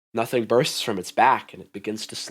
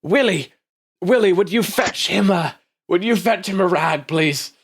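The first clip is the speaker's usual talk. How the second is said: higher, louder calling a servant; executive calling; 8 semitones higher, 3 dB louder than usual. Acted. Executive calling young Willie to fetch a rag. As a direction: summon the attendant briskly.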